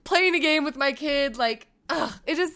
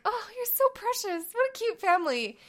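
cooing admirers; 9 semitones higher, 5 dB quieter than usual. Retold or imitated. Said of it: "looks at us like".

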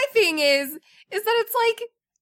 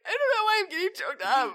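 high-pitched tearful imitation; mock choked up; 7 semitones higher, faster than usual. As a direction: mimic a man getting choked up. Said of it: "you're like".

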